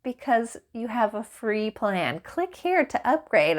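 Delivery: slow, high, mocking bot refusal; mock chatbot. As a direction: deliver the paywall line smugly. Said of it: "it said".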